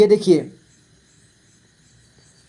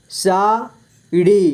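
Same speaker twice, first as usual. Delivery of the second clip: Sari split slowly into सा, ड़ी; syllable by syllable; much slower than usual. Shown as a demonstration. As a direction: stretch and separate सा and ड़ी in sari. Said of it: "इस तरह से आपको". (साड़ी को तोड़कर धीरे: सा, ड़ी)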